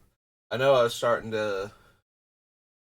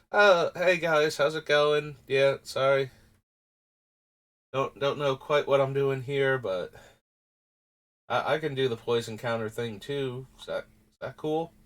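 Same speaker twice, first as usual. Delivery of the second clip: Finn; 4 semitones higher than usual. Retold or imitated. Streamer voicing the card character who wandered into the set, awkward and unsure. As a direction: play him as a shy latecomer asking if he can join, hesitant and apologetic. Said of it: "It's just like".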